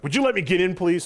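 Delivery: high-pitched imitation of an exasperated plea; mock yell. Imitating his tag partner blocked from entering, mock yell. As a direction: plead loudly, play-acting a blocked teammate. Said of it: "he would yell at me".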